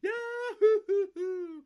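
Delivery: huge high yell of a yodeler going over; falling yodel yahoo. Retold or imitated. Yodeler's yell off the cliff, recreating the game show. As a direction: shout it way up high and loud as you fall.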